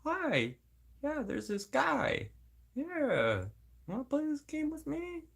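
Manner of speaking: very high, soft, mock-sweet caller; pretend phone call. Speaker pretending to phone someone about this guy, high mock-innocent voice. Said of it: "Just make a phone call".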